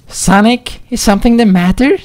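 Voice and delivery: very high, rushed little fox voice; worried young Tails. Acted. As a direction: blurt the question out fast and anxious. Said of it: "Tails asked, worried".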